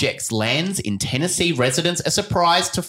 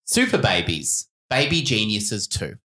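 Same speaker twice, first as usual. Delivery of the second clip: ordinary delivery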